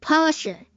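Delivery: Porsche as two syllables, POR-shuh; German-style Porsche, final E sounded; Porsche with the E pronounced. Shown as a demonstration. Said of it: "Pronounce the E".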